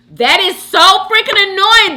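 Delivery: high, loud venting; loud exasperation. Real, not acted. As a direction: burst out in real annoyance. Vents about an annoying habit.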